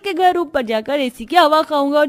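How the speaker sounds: put-on very high, excited little-boy cheer; gleeful child. Little Paras cheering about the aunt's AC, very high and gleeful. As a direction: cheer like a delighted kid.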